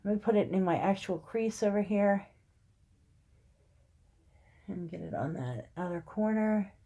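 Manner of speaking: normal talking voice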